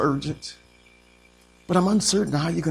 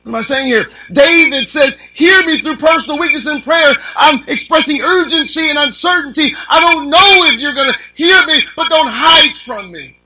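high and loud, urgent preacher's cry; preaching at full force; 10 semitones higher, 10 dB louder than usual. Acted. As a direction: drive the urgency home to the pews.

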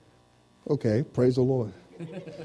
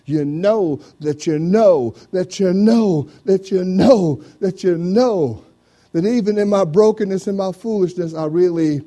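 preaching at full force; 7 semitones higher, 8 dB louder than usual. Acted. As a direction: hammer every "know" as you climb. Preacher building to a peak.

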